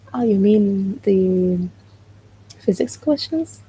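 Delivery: ordinary talk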